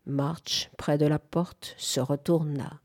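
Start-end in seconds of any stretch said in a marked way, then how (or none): none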